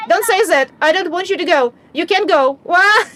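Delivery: put-on panicked child protest rising to a cry; upset child. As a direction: stop him from saying goodbye. Child refusing the farewell.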